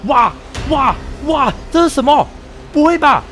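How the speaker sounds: sudden alarmed cries; startled. Real, not acted. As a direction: blurt it out in shock. Startled by monster in game.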